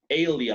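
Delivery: ALIA pronounced "AY-lia"; English EI-lia ending. Shown as a demonstration. Teacher repeats the hint to students, ALIA as "AY-lia". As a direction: begin ALIA with an "ay" glide. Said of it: "La segunda parte se dice alia".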